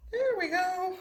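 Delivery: high, lilting There we go; playful high echo of There we go; sing-song high. Acted. Repeats the phrase differently.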